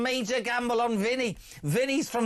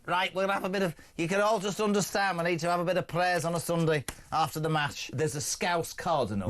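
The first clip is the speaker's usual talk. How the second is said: put-on lower, broad Scouse accent; Scouse cardinal; 5 semitones lower than usual. Host still voicing the cardinal, in a low Scouse accent. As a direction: preach it like a Liverpool priest after the match. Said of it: "stop doing this accent".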